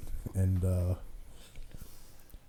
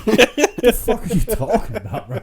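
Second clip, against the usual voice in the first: high, loud, laughing outburst; laughing disbelief; 12 semitones higher, 12 dB louder than usual. Retold or imitated. Hearing dad sold shrimp, loud laughing disbelief. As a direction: blurt it out in laughing shock. Reacting to shrimp sale news.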